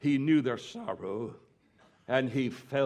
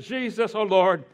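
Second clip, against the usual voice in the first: high, loud grieving plea; Martha pleading; 6 semitones higher, 7 dB louder than usual. Retold or imitated. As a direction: cry out to Jesus as a grieving sister. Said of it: "When Martha said".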